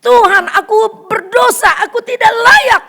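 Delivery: high, slow, loud crying prayer; weeping plea. Acted. Peter repenting to God, voice high and tearful. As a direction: cry out Tuhan, aku berdosa as if sobbing. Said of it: "dia nangis".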